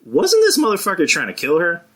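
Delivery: high, disbelieving question; incredulous. Retold or imitated. To his friend, mocking the plot in disbelief. Incredulous quote of own reaction.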